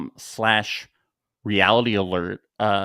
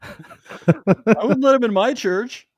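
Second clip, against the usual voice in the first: mock high-pitched priest; 13 semitones higher than usual. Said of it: "he's like".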